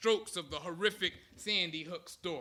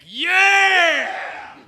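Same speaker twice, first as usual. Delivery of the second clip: high shouted answer, louder; louder team yell; 10 semitones higher, 14 dB louder than usual. Retold or imitated. Teammates yelling back louder to the captain. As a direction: shout it back even harder. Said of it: "a little louder, the team would yell back".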